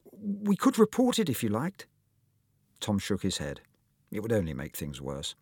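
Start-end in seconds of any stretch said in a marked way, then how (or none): 0.1-1.9 put-on high small-boy voice; little Paul. Small schoolboy offering help, high little voice. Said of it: "said little Paul".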